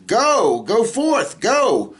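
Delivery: repeated go with strong emphasis; emphatic go. Shown as a demonstration. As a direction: press hard on every go. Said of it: "but it's go with emphasis".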